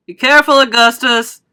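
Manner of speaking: shouted warning. A narrator's reading. Father shouts a warning to his son. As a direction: shout to warn your son. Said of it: "shouted Mister Gloop".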